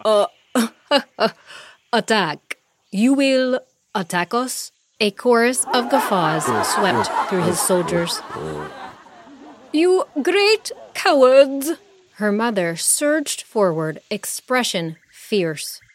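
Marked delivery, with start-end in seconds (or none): 0.0-4.7 chuckling through the words; scoffing while still laughing; laughing mockery. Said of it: "hooted with laughter". 9.7-11.7 higher, louder, slow furious cry; outraged shout. Acted. The queen shouting at the laughing monkey army.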